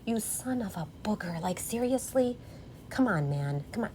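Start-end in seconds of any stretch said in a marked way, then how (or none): none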